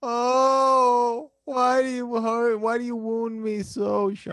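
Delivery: high, slow melodramatic lament; mock wounded. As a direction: play the wounded tragic hero. Mock hurt at Sean's joke.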